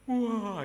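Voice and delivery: loud, high mock bawl; imitated wail. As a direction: imitate a child bursting into tears. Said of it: "Dia tambah nangis". (tiruan tangis keras)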